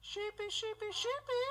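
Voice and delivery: high sing-song calling; calling sheep. Pretend.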